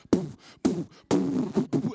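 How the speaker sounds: loud breathy popping shots; explosive blown "poof" noises; imitated gunfire puffs. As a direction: puff out paintball shots with the lips. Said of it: "I just start blowing".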